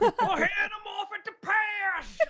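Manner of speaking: high, slow, exaggerated Southern drawl; hammy Southern accent. Guard on the manhunt calling out in a mangled Southern accent. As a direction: holler it like a cartoon sheriff. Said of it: "I don't even know what accent that is".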